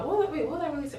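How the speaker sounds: high-pitched puzzled wondering; playful squeaky confusion; puzzled self-questioning. Real, not acted. Lost her thread, asks herself.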